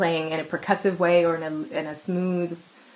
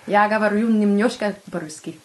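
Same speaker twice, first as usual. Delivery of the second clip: speaking Russian; a little Russian, playfully; 3 semitones higher, 3 dB louder than usual. Real, not acted. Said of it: "She'd be proud of how you said her name".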